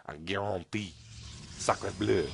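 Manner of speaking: hammed-up French accent. Caster jokes to viewers in mock French accent. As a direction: lay the French on thick. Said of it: "I was doing the French accent".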